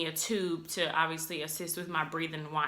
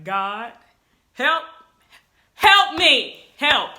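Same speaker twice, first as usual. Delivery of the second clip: high, loud desperate plea to God; panicked prayer; 5 semitones higher, 11 dB louder, much slower than usual. Retold or imitated. Nervous patient begging God for help before surgery, panicked.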